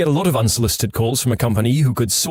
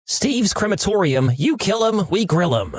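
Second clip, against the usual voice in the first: slow, higher put-on voice for a fake company; mock business greeting; 6 semitones higher than usual. Answering a scam caller, a higher, slower fake receptionist voice. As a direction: announce the crematorium like a cheery receptionist.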